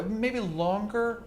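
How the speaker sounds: ordinary talk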